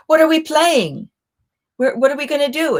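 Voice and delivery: put-on high, eager little-girl voice; childlike voice. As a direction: play it like an excited three-year-old. Stupina asks boy, childlike voice.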